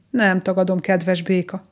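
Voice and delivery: usual speaking voice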